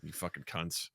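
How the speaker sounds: quiet, low mock-British aside; badly faked British accent, muttered low. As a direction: softly slip into a poor British impression. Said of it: "Horrible British impression".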